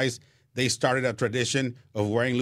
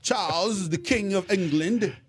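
mock posh British; 8 semitones higher than usual. Joking about the English king.

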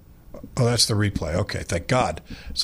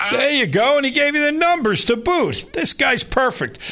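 thrilled by the stretch call; delighted praise; 11 semitones higher than usual. Real, not acted. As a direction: gush about the announcer. Praising announcer.

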